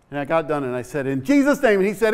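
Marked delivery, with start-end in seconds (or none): 1.0-1.8 higher, louder prayer ending; yelled prayer close. Retold or imitated. Preacher shouting the end of the prayer. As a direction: call the close loudly. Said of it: "I had to yell the prayer to him".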